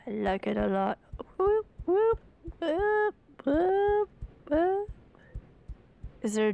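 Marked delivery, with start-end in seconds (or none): 1.4-4.9 very high repeated whoops; playful falsetto whoop calls. Pretend. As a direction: whoop up high like a cartoon creature. Voicing game sound effect.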